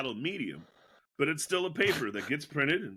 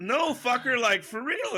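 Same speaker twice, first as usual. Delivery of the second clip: insistent mock retort; 10 semitones higher, 3 dB louder than usual. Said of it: "And I'm".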